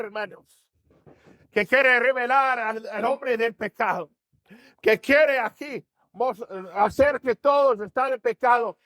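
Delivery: rising sermon fervor; fervent preaching. Acted. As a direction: preach it hard to the congregation. Preaching builds, pitch up.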